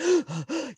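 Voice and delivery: a sharp high gasp like catching breath mid-sob; retold sobbing gasp. Recalling how he cried to his Marines, a sharp sobbing gasp. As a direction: gasp in as if crying hard. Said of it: "finally caught my breath".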